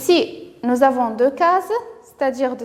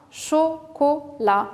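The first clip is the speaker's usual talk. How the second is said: "chocolat" slow, cho, co, la, each apart; syllable by syllable; much slower than usual. Shown as a demonstration. Teacher asks children where O is heard, syllable by syllable. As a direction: separate "chocolat" into three evenly spaced beats. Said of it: "on a trois syllabes".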